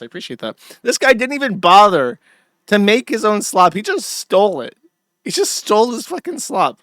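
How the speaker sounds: loud, disgusted tirade; angry rant. Real, not acted. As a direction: hammer the outrage home. Ranting at stolen clip.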